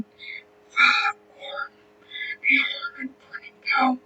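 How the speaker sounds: faint unvoiced whisper. A narrator's reading. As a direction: read it under breath. Reading tiny box print.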